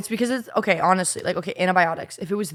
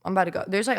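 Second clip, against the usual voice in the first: ordinary talk, nothing marked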